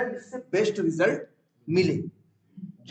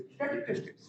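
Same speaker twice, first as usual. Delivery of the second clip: whispered answer; 9 dB quieter, faster than usual. Shown as a demonstration. Teacher drills class answers.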